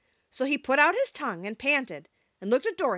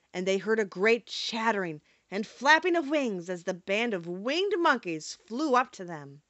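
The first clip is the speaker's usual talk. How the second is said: plain reading voice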